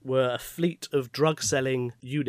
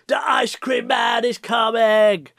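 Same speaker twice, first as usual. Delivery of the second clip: slow loud shout; calling out; 6 semitones higher, 5 dB louder, slower than usual. Pretend. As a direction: call it out like a street seller. Calling out like vendor.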